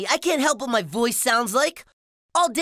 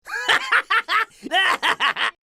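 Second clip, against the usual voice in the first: bully's laugh; 7 semitones higher than usual. Acted. Bobby laughing at Splat's dare, high cackle. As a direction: burst into a long cackle. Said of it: "Bobby laughed".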